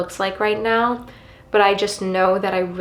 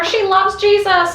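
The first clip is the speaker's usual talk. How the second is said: mock gossip; 10 semitones higher, 3 dB louder than usual. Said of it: "everyone's like".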